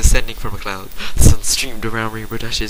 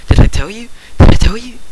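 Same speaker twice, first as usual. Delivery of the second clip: high, loud, bouncing excitement; can barely contain it, squeal it out; excited squeal; 11 semitones higher, 8 dB louder than usual. A narrator's reading. Scootaloo bursting in on her friends, excited squeal. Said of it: "excited squealing as Scootaloo bounced".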